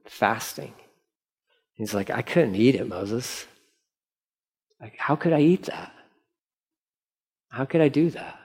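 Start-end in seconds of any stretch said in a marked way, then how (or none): 2.1-8.4 softer, pained pleading; Aaron quietly asking how he could eat; Aaron hushed and grieving before Moses. Retold or imitated.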